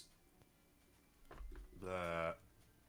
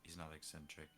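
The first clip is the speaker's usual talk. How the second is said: slow, quiet, spaced-out phrases; soft, calm Druid; 7 dB quieter, slower than usual. Shown as a demonstration. Player demonstrating their Druid's voice, soft and calm. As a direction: speak gently with long pauses. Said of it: "It's kinda soft and just calm".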